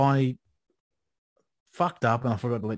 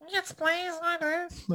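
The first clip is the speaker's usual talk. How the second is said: mock little-kid voice, much higher; eager kid; 4 dB quieter, much slower than usual. Schoolkid answering the recruiter in a high mock voice.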